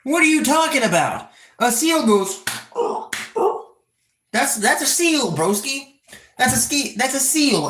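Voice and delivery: high-pitched, exasperated arguing; indignant correction. Real, not acted. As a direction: argue the point in high disbelief. Indignantly corrects viewer on animals.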